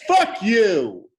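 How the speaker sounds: playful loud curse; mock yell. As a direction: fling it at a friend in jest. Mock insult at friend.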